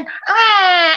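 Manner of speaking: high, sudden mock wail; whining imitation cry; imitated child's crying. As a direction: bawl like a baby starting up. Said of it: "Ketika lima menit kemudian".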